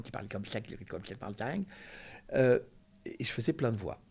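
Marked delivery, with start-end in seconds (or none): 0.0-1.6 crazy math teacher with a lisp; fast lisping character voice. Retold or imitated. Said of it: "avec un cheveu sur la langue".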